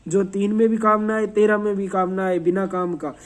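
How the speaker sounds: usual speaking voice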